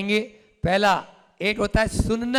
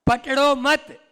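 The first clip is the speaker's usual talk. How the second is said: पकड़ो मत said high as one phrase, then stop; pause after मत; 6 semitones higher, 4 dB louder, slower than usual. Shown as a demonstration. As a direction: hold a long silence after मत. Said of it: "एक शब्द है".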